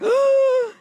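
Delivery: big, high mock gasp; hammed-up gasp of horror; shocked gasp. Casting people hearing no new headshots, shocked gasp.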